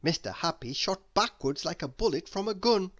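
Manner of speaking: ordinary delivery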